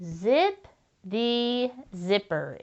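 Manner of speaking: zip the zipper said slowly, each word spaced; slow dictation. Shown as a demonstration. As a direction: dictate slowly to children who are writing. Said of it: "Is this the end of the sentence?".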